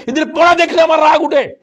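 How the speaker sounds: preacher's mock rage; mock angry outburst. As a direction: flare up at the lazy students. Preacher scolds students' poor study.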